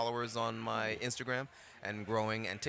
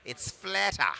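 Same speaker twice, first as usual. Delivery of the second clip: higher, louder laid-back Australian "flatter"; Australian accent; 10 semitones higher, 7 dB louder, slower than usual. Shown as a demonstration. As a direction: flatten it like an Aussie.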